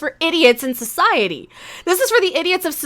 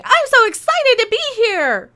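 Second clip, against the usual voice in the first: higher, gushing mock of the annoying ensign; mock excited ensign; 6 semitones higher than usual. Reviewer parodies the annoying ensign's eager line, gushing and high. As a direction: gush it like the over-eager newbie. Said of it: "Oh, she's annoying".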